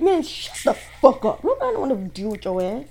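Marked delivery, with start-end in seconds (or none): 0.0-1.4 high-pitched, sudden angry outburst; pissed off. Real, not acted. Reader telling off the jealous ex, angrily. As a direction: cut them off in real irritation. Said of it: "I'm pissed off all of a sudden".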